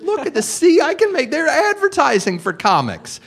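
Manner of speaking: put-on like a kid waving a job ad; excited showing off. Showing his parents the comedy ad, high and thrilled.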